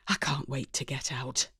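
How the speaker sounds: ordinary delivery, nothing marked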